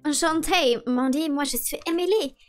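put-on French spoken with a mild American accent; Emily's lightly put-on American accent in French. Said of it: "avec votre accent ridicule".

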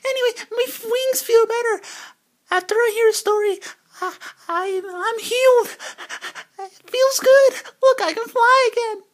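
very high falsetto bird voice; bird character. Pretend. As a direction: squeak it out high, happily healed.

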